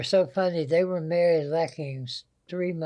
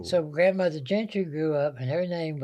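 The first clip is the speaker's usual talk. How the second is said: ordinary delivery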